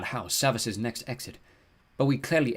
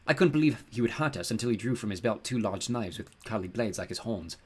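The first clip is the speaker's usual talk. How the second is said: ordinary delivery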